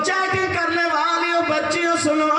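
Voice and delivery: chanted, sing-song sermon delivery; preaching. Acted. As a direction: intone the story to the congregation. Chanted exhortation to listeners.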